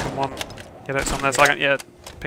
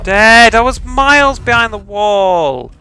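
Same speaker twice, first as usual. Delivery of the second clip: louder, higher, slowed complaint; frustrated outburst; 5 semitones higher, 10 dB louder, slower than usual. Real, not acted. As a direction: vent the annoyance loudly. Just killed, frustrated.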